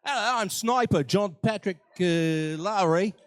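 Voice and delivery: lightly voiced Australian sniper; playful mock Australian accent. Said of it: "he talks like an Australian".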